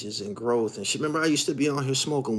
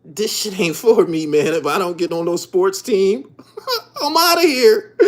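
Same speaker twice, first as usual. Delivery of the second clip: laughing quote; 6 semitones higher, 5 dB louder than usual. Retold or imitated. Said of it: "I'm like".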